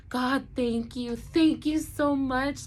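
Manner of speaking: high, slow, tearful thank-you to God. Retold or imitated. Crying in the car, thanking God after signing papers, slow and tearful. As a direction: let the gratitude spill over through tears. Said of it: "just crying my eyes out".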